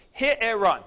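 he-e-run, in three clear syllables at normal pace. Shown as a demonstration. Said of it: "Three syllables".

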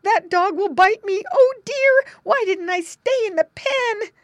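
very high, loud panicked squealing; a piglet sure he will be bitten; frightened piglet. A narrator's reading. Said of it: "squealed Squinty".

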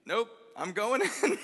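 slow, high, laughing imitation of a resigned old man; deadpan give-up, cracking up while saying it; old man giving up on the boat, slow and laughing; old man's reply. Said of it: "He goes".

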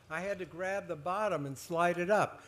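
usual speaking voice

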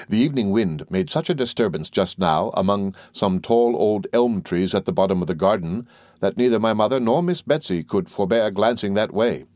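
ordinary delivery

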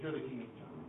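soft, low placating reply; pacifying. Acted. Giraffe pacifying the lion who demands recognition, placating. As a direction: brush him off softly to keep him happy. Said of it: "pacifies the King of the Jungle".

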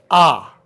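"ah" said with the mouth wide open; open-mouthed vowel. Shown as a demonstration. Lecturer showing how we make the sounds, open-mouthed "ah". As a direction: drop the jaw for a falling "ah". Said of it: "mouth wide open".

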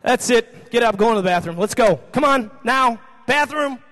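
loud, stern string of orders; fed-up dad. Retold or imitated. Father sending son to the bathroom, loud stern orders. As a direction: act out a parent at the end of patience, loud.

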